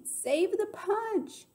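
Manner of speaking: very high, stretched-out yell; slow, soaring call. A narrator's reading. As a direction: draw each word out in a high holler. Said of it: "Gloria Dump yelled to me".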